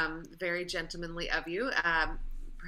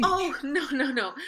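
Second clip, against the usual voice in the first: flustered laugh; 9 semitones higher, 7 dB louder than usual. Acted.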